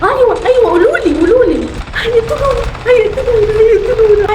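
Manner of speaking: high, frantic panic; she's sure they'll kill her, frantic; panicked. Real, not acted. Panicking under enemy fire. (خايفة ومذعورة بصوت عالي)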